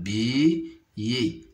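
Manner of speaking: "Billet" with bi drawn out, then yé; syllable by syllable. Shown as a demonstration. Teacher counting syllables for pupils, "Billet" split in two. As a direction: separate both syllables of "Billet". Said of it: "se compose de deux syllabes".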